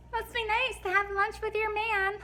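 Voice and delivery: high, quick teasing voice; chirpy coworker. Acted. Coworkers passing the couple in the car, chirpy teasing. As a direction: tease in a put-on high voice.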